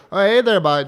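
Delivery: put-on Canadian accent, teasing a friend; mock Canadian accent. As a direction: tease him with a goofy Canadian voice. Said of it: "I did not sound like that".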